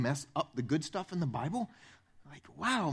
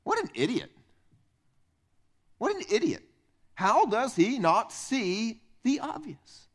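scoffing at a fool; 5 semitones higher than usual. Retold or imitated. A young reader scoffing at the servant. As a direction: roll your eyes at the idiot in the story. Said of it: "I would read this story and go".

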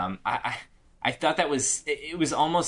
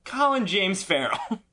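mock gossip reveal; 8 semitones higher, slower than usual.

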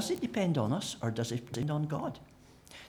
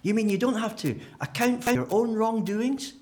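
mildly indignant, higher objector voice; 9 semitones higher, 4 dB louder than usual. Retold or imitated. A hint of a shocked churchgoer objecting to grace. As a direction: voice a shocked critic. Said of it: "some people will say".

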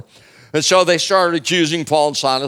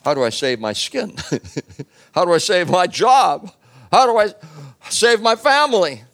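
from plain to much higher, louder panic; rising panic; 7 semitones higher, 4 dB louder than usual. Acted. Jailer fearing for his skin and family, building panic. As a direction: build from worry to desperate panic. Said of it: "Now, I'm thinking".

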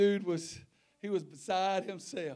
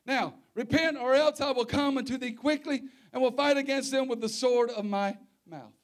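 preaching; 6 semitones higher, 9 dB louder than usual. Acted. Reads scripture at full force.

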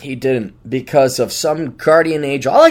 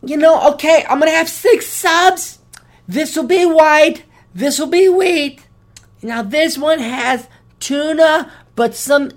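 much higher, slow prissy customer voice; mock fussy customer; 11 semitones higher, 4 dB louder, slower than usual. Comedian mocking a Subway customer ordering, high prissy voice. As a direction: order like a picky entitled customer. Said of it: "Subway woman or the Chipotle woman".

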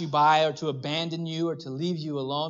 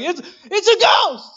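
much higher, louder, slow hammed-up terror; terrified; 9 dB louder, slower than usual. Disciples seeing Jesus on the water, mock terrified. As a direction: stretch out the fright like a spooked kid. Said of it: "they're terrified".

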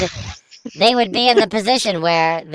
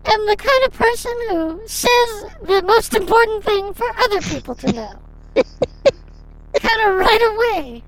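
Cindy voice; 9 semitones higher than usual. Acted. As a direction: play a lonely woman, pitched high.

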